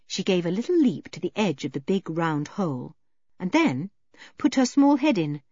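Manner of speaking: ordinary delivery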